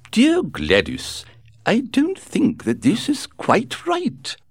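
put-on high, slow, fragile old duchess; a delicate elderly duchess, gently chiding; frail withering duchess. A narrator's reading. Duchess objects.

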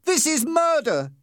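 high-pitched cry of alarm; understated scream, very high; screamed protest. A narrator's reading. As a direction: cry out as a terrified victim. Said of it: "screamed Peter".

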